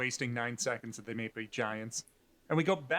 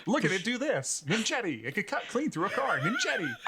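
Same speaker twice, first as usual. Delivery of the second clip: hyped TV salesman, pitched higher; infomercial pitchman; 7 semitones higher than usual. Retold or imitated. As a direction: sell it like a late-night ad. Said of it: "Something that they shout in between everything".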